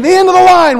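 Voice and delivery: high, very loud herding shout; airline lady calling out. Retold or imitated. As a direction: holler it across a crowded terminal. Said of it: "the lady just kept saying".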